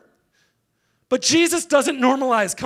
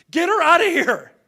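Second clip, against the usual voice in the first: high, raised, mock-outraged order; indignant outburst; 7 semitones higher, 4 dB louder than usual. Church reacting to an unwanted woman, indignant outburst. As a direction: call out a dismissive order, pitched way up.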